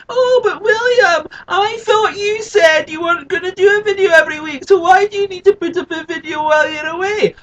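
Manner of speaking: a hammed-up shrill-pitched annoying viewer. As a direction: nag William like a pesky heckler. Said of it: "annoying voice".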